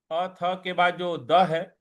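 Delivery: ordinary talk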